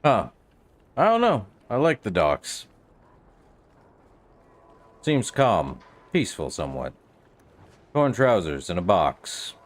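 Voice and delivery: ordinary talk, nothing marked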